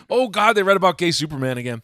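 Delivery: loud, high mock panic; mock screaming. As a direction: imitate people running off in exaggerated horror. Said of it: "people are gonna run screaming like".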